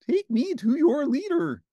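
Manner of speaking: high, loud space creature; alien voice. Acted. Ernie playing an alien landing, in a high loud voice. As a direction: do a little alien visitor.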